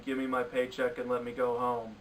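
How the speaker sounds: flat, bored, lifeless read; imitating a bored actress going through the motions; bored Kate McKinnon. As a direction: sound like you'd rather be anywhere else. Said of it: "She sounded so bored in this movie".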